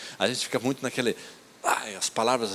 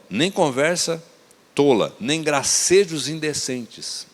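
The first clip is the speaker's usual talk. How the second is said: slow emphasis; much slower than usual. Acted. Repeats verse slowly for emphasis.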